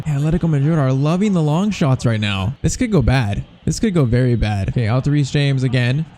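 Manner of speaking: ordinary delivery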